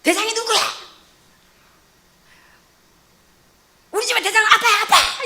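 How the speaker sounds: high, loud mock yell of a scolding dad; imitated angry shouting. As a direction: holler it like a father laying down the law, hammed up. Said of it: "그러면서 막 소리 지르더만".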